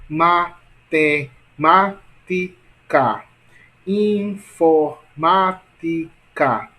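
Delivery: slow, each syllable set apart. Shown as a demonstration. Teacher showing class how many syllables, syllable by syllable. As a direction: break the words into separate, stressed syllables.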